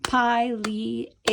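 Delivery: Pileated in four slow separate beats, "pie, lee, ay, tid"; syllable by syllable. Shown as a demonstration. Teacher counting syllables in the word, syllable by syllable. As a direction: break Pileated into syllables, long i first. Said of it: "four syllables if we say it that way".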